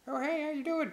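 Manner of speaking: high falsetto hello; pitched-way-up imitation; mock flirty greeting. Acted greeting at bar.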